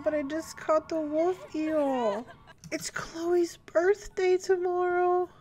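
mourning the loss through a weak laugh; sad disbelief. Real, not acted. Player grieving her sim before the birthday, sad. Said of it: "I'm genuinely so upset".

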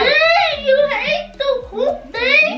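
high-pitched, incredulous exclamation; real astonishment. Real, not acted. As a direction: call it out in shocked disbelief. Child exclaims at eaten snack.

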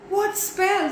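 high, baffled question; puzzled. A narrator's reading. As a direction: ask with no idea what is meant.

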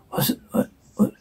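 breathy whispered what. Acted. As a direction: whisper it in confusion. Whispered confusion.

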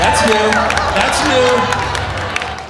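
high, loud shout of surprise; startled exclamation. Real, not acted. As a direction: cry out over the crowd in amazement. Startled by the audience's reaction.

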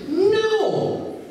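high, drawn-out "no"; emphatic refusal. Real, not acted. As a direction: reject it with a long, high cry. Rebukes congregation's imagined self-seeking thought.

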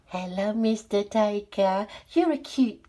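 ordinary delivery

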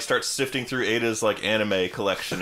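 ordinary delivery

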